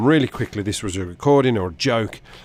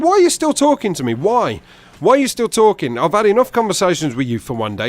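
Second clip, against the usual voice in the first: exasperated high, loud demands; irate stranger; 8 semitones higher, 4 dB louder than usual. Retold or imitated. Stranger to persistent caller, exasperated outburst. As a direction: lay into the caller as the angry man.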